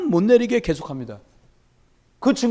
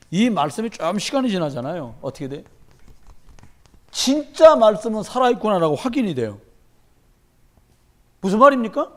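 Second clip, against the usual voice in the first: ordinary delivery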